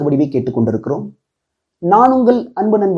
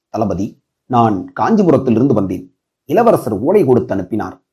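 put-on low, deferential young messenger; humble; 7 semitones lower than usual. A narrator's reading. Messenger reporting to the fort commander, humbly. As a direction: report respectfully in a lowered voice. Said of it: "பணிவான குரலில்".

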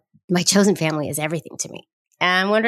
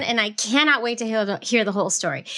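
ordinary talk

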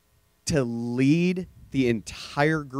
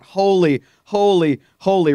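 crying out; 5 semitones higher, 4 dB louder than usual. Retold or imitated. Angels crying out holy.

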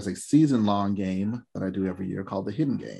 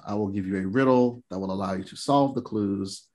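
ordinary delivery

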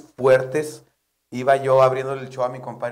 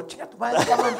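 higher, laughing mock insult; joking insult; 9 semitones higher, 4 dB louder than usual. The comic jokingly insults his compadre backstage, higher laughing voice. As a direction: curse your buddy through laughter. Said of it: "mi compadre, yo le digo".

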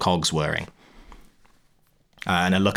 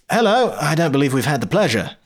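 polite Polly; 7 semitones higher, 3 dB louder than usual. Acted. GM voices Polly greeting.

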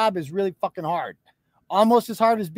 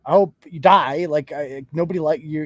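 ordinary talk, nothing marked

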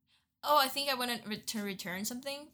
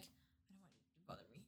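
muttered thought; 7 semitones lower, 27 dB quieter, faster than usual. Retold or imitated. Inner thought about clerks.

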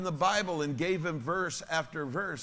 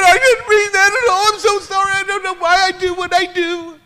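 whimpering mock plea; 16 dB louder than usual. Pastor mocks the bully begging, very high and whiny. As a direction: ham up a terrified big man pleading. Mocking the big guy begging.

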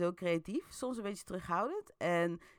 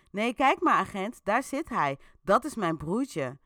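nervous bluff; 3 semitones higher, 7 dB louder than usual. A narrator's reading. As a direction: sell the lie to the cop. Boels bluffs to agent.

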